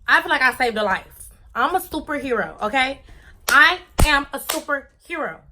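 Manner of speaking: loud, high proud superhero boast; excited bragging. Real, not acted. Boasting to viewers she's a superhero, loud and high. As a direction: hype yourself up as a superhero. Bragging about saving him.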